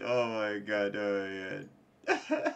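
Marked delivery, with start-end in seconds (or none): none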